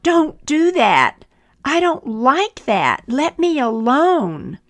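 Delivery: high, loud protesting cry; teacup yelling. Acted. Teacup yelling at the potter, loud and high. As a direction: yell to be left alone. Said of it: "and I yelled out".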